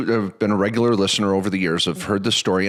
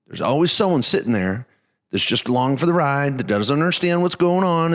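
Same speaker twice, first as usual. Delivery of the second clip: raised, hammed-up Texan voice; terrible Texan accent; 5 semitones higher than usual. Texan investor explaining dumb money to host, mock Texan accent. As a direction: imitate a folksy Texan with a bad accent. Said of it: "that was a terrible Texan accent".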